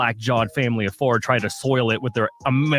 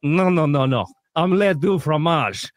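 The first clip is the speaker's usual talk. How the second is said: insistent fake French; 3 semitones higher than usual. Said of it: "And I said".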